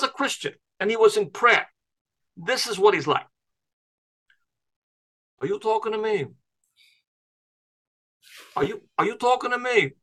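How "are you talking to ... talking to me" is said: lightly done New York tough guy, softer; a mild De Niro impression with talking punched; a hint of a New York accent; Robert De Niro. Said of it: "I'm gonna do my Robert De Niro".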